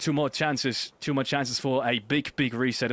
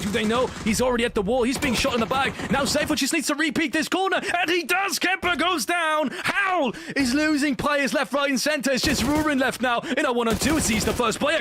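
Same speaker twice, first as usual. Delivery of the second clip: fast, rising excited call; esports caster; 10 semitones higher, 3 dB louder than usual. Real, not acted. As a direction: ride the action higher and higher. Live play-by-play.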